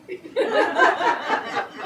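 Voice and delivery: high airy burst of laughter; real laugh. Real, not acted. Author laughing about writing three scenes overnight, breathy laugh.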